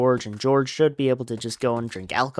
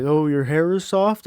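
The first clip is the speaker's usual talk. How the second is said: simple, marvelling voice, pitched up; lightly mocked dopey wonder, louder and higher; Lenny; 4 semitones higher, 4 dB louder than usual. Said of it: "he's like".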